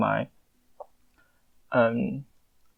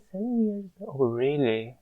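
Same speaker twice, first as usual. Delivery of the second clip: startled disbelief; 2 semitones higher, 7 dB quieter than usual. Real, not acted. Disbelief at own age.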